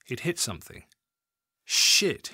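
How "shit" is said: sharp unvoiced hiss; hissed curse. A narrator's reading. Bill hits the box with his spade and hisses a curse. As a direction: hiss the curse under the breath. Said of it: "he hissed".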